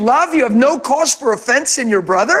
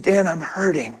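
soft confession; 7 semitones lower, 5 dB quieter than usual. Retold or imitated. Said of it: "And he said".

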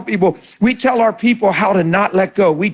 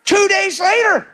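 high shout of disbelief; astonished; 11 semitones higher, slower than usual. Retold or imitated. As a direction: blurt it out, stunned by the news. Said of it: "I go".

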